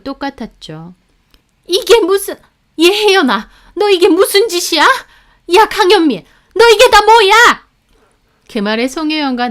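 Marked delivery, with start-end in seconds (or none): 1.7-7.6 much higher, louder shouting; mother-in-law screaming in outrage; furious yelling. A narrator's reading. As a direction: explode at them both. Mother-in-law explodes at the video.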